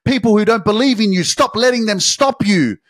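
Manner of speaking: high, loud desperate plea to be let out; trapped soul screaming. Pretend. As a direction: cry out like a soul locked inside. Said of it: "It's screaming at you".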